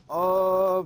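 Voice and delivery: sudden loud high cry of surprise; startled yell. Real, not acted. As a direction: yelp as if you just fell. Se cae tras saltar. (grito de sorpresa)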